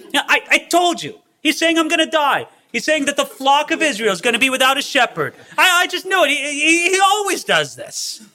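very high, stammering comic grievance; hammed-up whining, climbing in pitch as it goes; exasperated complaining. King griping to his fellow king, comically exasperated. Acts out Ahab complaining to Jehoshaphat.

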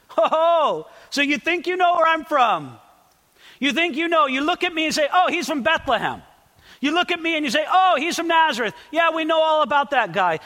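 high, mocking challenge; ironic, sarcastic. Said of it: "he was being ironic or sarcastic".

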